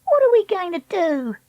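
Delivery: put-on high-pitched, fretful girl; anxious Treacle. Treacle asking Dakota for a way out, high and fretful. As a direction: ask it high and helpless.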